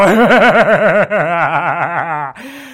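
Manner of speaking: loud, voice unlike the narrator's; loud laughter. A narrator's reading. Revellers laugh at the quarrel, loud. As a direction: burst into laughter.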